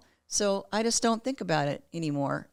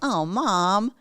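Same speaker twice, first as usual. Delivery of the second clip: put-on higher, eye-rolling teenager groan; exasperated teen; 3 semitones higher, 5 dB louder than usual. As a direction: whine it like an embarrassed daughter. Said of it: "they were like".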